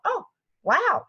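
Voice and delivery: delighted astonishment; student amazed by shot. Retold or imitated. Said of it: "one would go five times and go".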